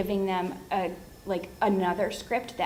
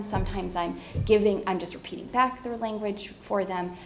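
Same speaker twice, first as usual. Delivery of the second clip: ordinary talk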